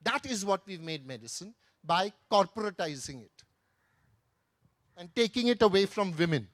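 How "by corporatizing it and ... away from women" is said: ordinary talk, nothing marked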